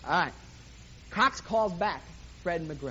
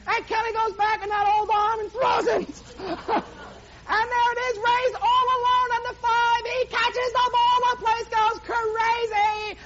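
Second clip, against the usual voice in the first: higher, louder, slowed-down climactic call; live play-by-play; 12 semitones higher, 5 dB louder than usual. Acted. Preacher calling Ray's Hail Mary catch, climactic announcer. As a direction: draw out the winning catch.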